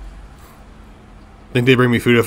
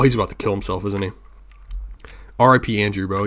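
ordinary talk, nothing marked

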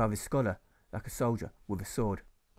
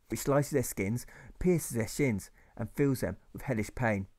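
ordinary delivery, nothing marked

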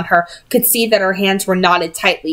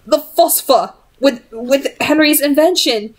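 mildly shaken, not broken; slight tremble; 6 semitones higher than usual. Acted. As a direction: voice a touch raised and wavering. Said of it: "slight tremble to her voice".

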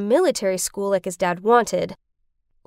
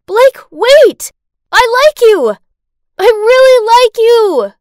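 screamed high and loud after him; desperate shout; 11 semitones higher, 13 dB louder than usual. Acted. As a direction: yell it out in despair. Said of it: "shouted in despair".

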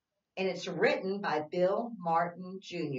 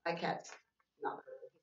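breathy whispered aside, much softer; 6 dB quieter than usual. Real, not acted. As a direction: let the aside slip out under the breath. Aside to herself.

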